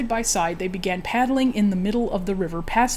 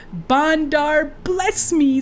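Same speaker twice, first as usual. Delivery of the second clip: put-on a bit higher, slurring drunkenly; slurred; 6 semitones higher, much slower than usual. Captain spotting the burning village, drunkenly slurred. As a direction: let the words smear like a drunk. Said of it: "slurred the captain".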